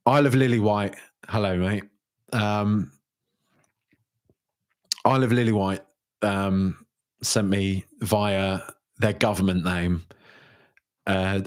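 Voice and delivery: usual speaking voice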